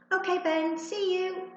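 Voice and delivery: high, loud call from upstairs; mum calling back. A narrator's reading. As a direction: mum answering down the stairs.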